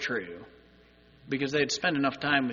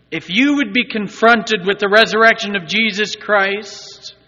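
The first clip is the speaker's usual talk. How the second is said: raised, loud, emphatic sermon voice; loud preacher's appeal, pitched up; impassioned preaching; 6 semitones higher, 9 dB louder than usual. Real, not acted. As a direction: press it on the congregation.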